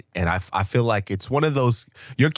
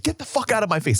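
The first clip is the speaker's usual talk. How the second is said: mock yell, raised in pitch only; retold scream; 10 semitones higher, faster than usual. Old man to the waitress, mock scream. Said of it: "screaming at the girl".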